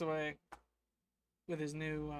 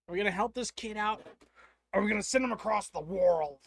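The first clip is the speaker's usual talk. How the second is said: loud call to the viewers; louder and louder hype for the stream; rallying chat; 3 semitones higher, 11 dB louder than usual. Real, not acted. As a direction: shout the question out to chat. Calling out to chat.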